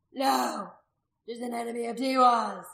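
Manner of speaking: forceful crowd answer; loud refusal. A narrator's reading. Man answering the priestess's incitement, loud refusal. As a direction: declare her an enemy loudly.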